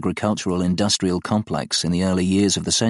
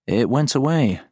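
higher-pitched, pondering aloud; musing aloud; 6 semitones higher than usual. A narrator's reading. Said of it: "Joe mused aloud".

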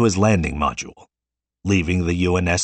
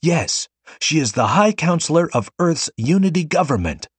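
higher, mild mock crowd call; a hint of many voices proclaiming; crowd shouting; 8 semitones higher than usual. A narrator's reading. Plaza crowd proclaiming the girl's title, mild shout. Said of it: "shouted in unison".

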